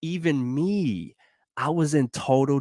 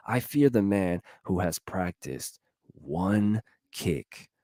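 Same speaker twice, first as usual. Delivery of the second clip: usual speaking voice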